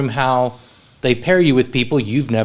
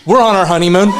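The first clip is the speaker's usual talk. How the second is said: louder, higher comic punchline; mock lovey-dovey announcement; 4 semitones higher, 6 dB louder than usual. Friend teasing a preacher as a fake newlywed, mock-proud. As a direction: imitate a proud newlywed for the laugh.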